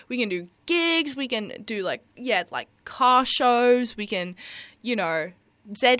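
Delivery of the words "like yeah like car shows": normal talking voice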